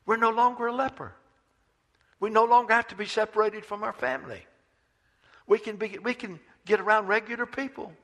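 a healed leper can't believe it; joyful amazement. Acted. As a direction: leper overjoyed at seeing his hands. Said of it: "and said".

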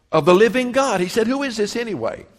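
high, loud indignant cry; outraged challenge. Acted. David defying the giant before the army, loud and indignant.

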